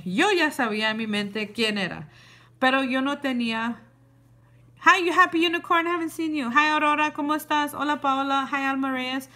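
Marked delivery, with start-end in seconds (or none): 4.8-9.3 higher, louder bright hello; cheerful greeting. Real, not acted. Pausing the story to greet viewers by name, upbeat. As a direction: greet the chat happily.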